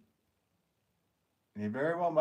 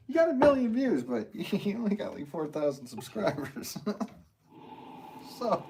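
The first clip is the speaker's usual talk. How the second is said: laughing; 12 semitones higher, 4 dB louder than usual. Real, not acted. Laughing reading a chat message.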